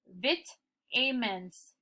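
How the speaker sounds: vitamins read slowly as "vit... AY-mens"; wrong sounded-out vitamins. Shown as a demonstration. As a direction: break vitamins after "vit", long A following. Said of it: "change the pronunciation of the word".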